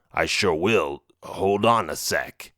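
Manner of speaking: low troll voice, drawn out; low, unhurried creature voice. A narrator's reading. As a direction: stall slyly as a troll. Troll stalls before summoning monster.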